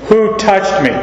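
"Who touched me?" pitched high, stress on WHO; emphatic. Acted. Christ's question as we'd say it in English, emphatic high WHO. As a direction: hit WHO high, then fall through "touched me". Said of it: "it's emphatic".

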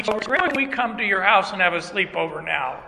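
usual speaking voice

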